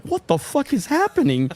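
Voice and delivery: high-pitched retold shock; bewildered disbelief. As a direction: blurt it high, baffled by what you saw. Retold shock at manager's violence.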